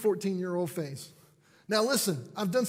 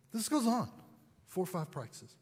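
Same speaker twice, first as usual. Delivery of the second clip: ordinary delivery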